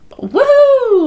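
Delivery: loud high cheer; woohoo. Real, not acted. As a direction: whoop with glee. Thrilled jump.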